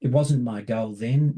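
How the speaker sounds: ordinary delivery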